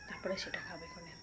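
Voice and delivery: quick hushed whisper aside. Real, not acted. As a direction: drop to a whisper for this bit. Aside while cooking.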